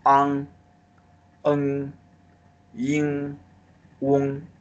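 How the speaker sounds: Ang, eng, yin, wong sounded with a buzz in the nose; nasal finals. Shown as a demonstration. Teacher showing the class the back nasal finals. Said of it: "have the vibration in your nose".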